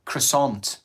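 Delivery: croissant as "kruh-SAHNT"; American-style "kruh-sont"; American pronunciation. Shown as a demonstration. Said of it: "How do Americans say croissant?".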